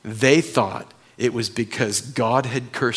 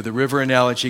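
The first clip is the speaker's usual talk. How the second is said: ordinary talk, nothing marked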